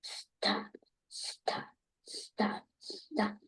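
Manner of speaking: whispered "Stop" with a soft P; quiet P. Real, not acted. Child whispering stop while practising. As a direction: whisper each "Stop" gently. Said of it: "that's a nice quiet P".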